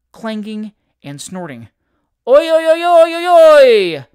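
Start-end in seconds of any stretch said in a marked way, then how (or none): 2.3-4.1 long, high, very loud hail; sustained high yell running the calls together; shouted hail. A narrator's reading. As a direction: holler across the water from a distant boat. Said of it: "shouted half a dozen voices".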